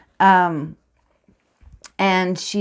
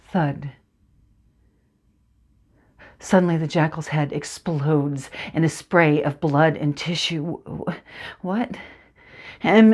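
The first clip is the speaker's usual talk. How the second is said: dramatic monologue narration; 2 semitones lower than usual. Acted. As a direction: draw the listener into the dark orchard.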